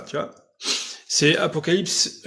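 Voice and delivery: ordinary talk, nothing marked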